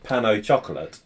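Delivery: pan au chocolat with English chocolate ending; no French accent on pan au chocolat; anglicised pan au chocolat. Shown as a demonstration. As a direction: say chocolat as plain English CHOK-uh-lut. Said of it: "if you don't try and pronounce".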